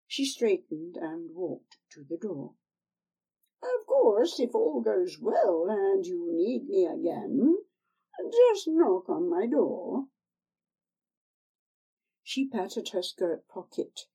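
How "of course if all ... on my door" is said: put-on old crone. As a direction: coax her like a sly old crone. Old woman at the door.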